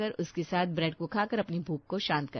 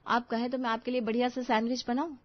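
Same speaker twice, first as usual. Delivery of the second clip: plain reading voice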